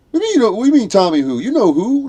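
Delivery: high, loud mock exasperation; incredulous. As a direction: imitate an impatient buddy who expects you to know. Buddy's impatient comeback, retold.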